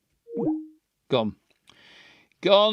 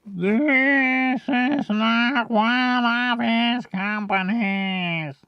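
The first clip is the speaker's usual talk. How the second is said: high, extremely slow drone; Adam's slow talk; 10 semitones higher, much slower than usual. Shown as a demonstration. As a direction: hold each word long and high. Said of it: "he's gonna talk slower".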